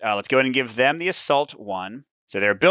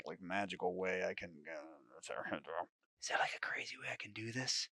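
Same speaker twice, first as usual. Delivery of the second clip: very soft, trailing into a whisper; thinking aloud quietly; 5 semitones lower, 20 dB quieter than usual. Real, not acted. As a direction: mull it over under your breath.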